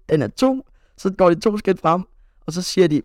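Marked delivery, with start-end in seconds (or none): 0.1-0.7 coach calling. Retold or imitated. Coach Kim calls out in game.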